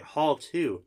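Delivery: acted baffled query on "Hall two"; puzzled echo. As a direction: repeat "Hall two" in disbelief.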